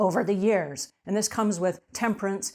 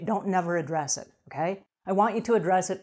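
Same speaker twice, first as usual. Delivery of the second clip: ordinary delivery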